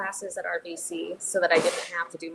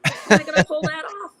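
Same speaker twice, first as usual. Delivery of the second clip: higher, louder, laughing disbelief; mock overwhelmed; 9 semitones higher, 6 dB louder than usual. Director joking about a huge musical, mock overwhelmed. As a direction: play up being daunted, with a laugh.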